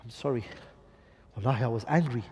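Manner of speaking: low, soft, subdued apology; hushed, low-pitched mock contrition; calmed-down apology. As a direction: say sorry quietly, voicing a husband who has cooled off. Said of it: "when he calms down, he says".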